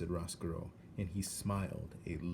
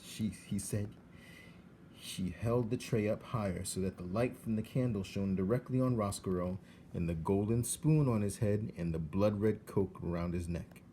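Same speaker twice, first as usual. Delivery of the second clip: ordinary delivery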